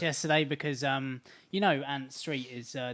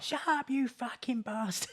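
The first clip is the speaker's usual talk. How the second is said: put-on much higher, slow bratty shout of a child; mock kid's yell; 11 semitones higher than usual. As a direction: yell it like a foul-mouthed little kid. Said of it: "the kid's shouting abuse back".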